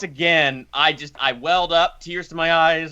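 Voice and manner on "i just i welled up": usual speaking voice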